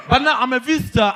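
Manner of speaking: ordinary delivery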